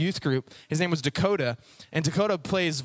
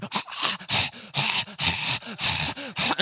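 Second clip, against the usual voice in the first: choking dog gasps; 5 semitones higher than usual. Acted. Dog straining against the choke chain, choked gasping. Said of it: "he'd just be like".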